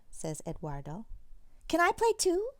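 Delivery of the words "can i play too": high, loud eager ask; eager small voice. Acted. Sister asking to join pirate play, high and eager.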